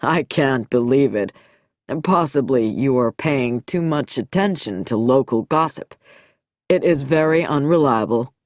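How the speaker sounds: low, amused and mocking doctor; lightly amused, faintly taunting. A narrator's reading. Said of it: "Nicholson's voice sounded amused".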